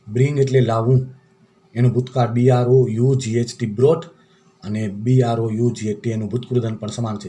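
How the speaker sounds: usual speaking voice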